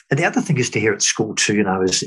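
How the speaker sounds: usual speaking voice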